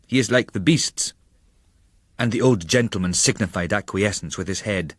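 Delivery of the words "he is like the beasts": mild anger. Acted. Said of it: "with an angry nod".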